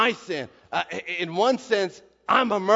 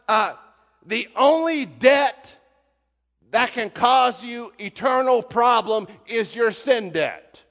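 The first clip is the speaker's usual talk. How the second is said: ordinary talk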